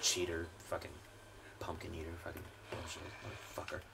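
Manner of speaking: very low, hushed grumbling; muttered whispering curses. Real, not acted. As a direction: curse under your breath, barely audible. Sulking after being cheated.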